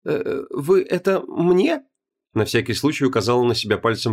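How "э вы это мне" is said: put-on high, slow, unsure young woman; startled young woman. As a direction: ask very slowly, pitched way up. Heroine startled by demon.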